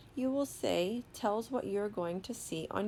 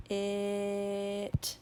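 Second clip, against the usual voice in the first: "It" with the vowel held long; slow sounding-out. Shown as a demonstration. Teacher models reading for children, "It" drawn out slowly. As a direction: stretch "It" slowly, one level tone. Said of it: "saying the word slowly".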